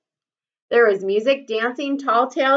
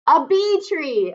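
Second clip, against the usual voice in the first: called back; 6 semitones higher, slower than usual. Acted. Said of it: "a voice called back".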